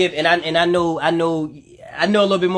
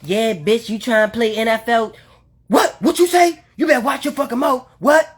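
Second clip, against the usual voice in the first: mock parent yelling; 4 semitones higher than usual. Parent voiced scolding child.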